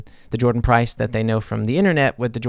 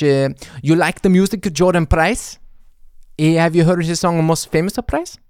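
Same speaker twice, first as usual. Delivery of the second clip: lightly put-on Swedish lilt, a bit higher; mock Swedish accent; 4 semitones higher, 3 dB louder than usual. A hint of Swedish accent pitching the song to a listener. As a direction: play a Swede pitching the song. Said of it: "how we sound here in Sweden".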